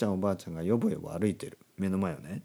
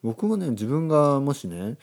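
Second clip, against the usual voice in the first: ordinary delivery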